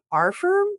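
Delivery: "our firm" rising up high like a question; quizzical rising tone. Retold or imitated. Host imitating how Sarah questioned the name, rising. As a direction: ask "firm" doubtfully, pitch climbing. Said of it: "that's how Sarah said it".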